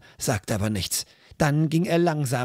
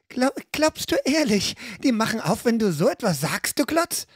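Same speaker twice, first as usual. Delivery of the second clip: exasperated; 7 semitones higher than usual. Acted. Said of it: "fragte sie mit rollenden Augen".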